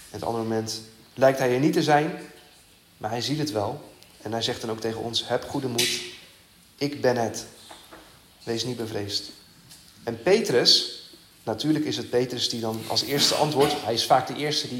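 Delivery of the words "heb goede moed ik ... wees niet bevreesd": softer, gentle repetition of the comfort; preacher telling the congregation not to fear, softly and tenderly; tender reassurance. Acted. Quiet and reassuring, spoken straight to the listeners.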